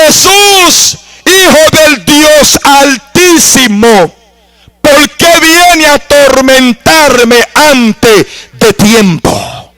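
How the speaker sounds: high, drawn-out slow cry to Jesus; the demoniac repeating his plea, slow and high; possessed man crying out slowly. Retold or imitated. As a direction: stretch every word like a tormented soul. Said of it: "Le dijo".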